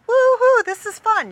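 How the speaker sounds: high, loud whoop; thrill scream. Retold or imitated. Riders whooping down the big drop. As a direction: scream with delight going down. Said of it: "Everyone's screaming and going".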